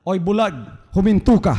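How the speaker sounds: mild mock scold; scolding apostles. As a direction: hush the noisy beggar sharply. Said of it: "sinabi sa kanila".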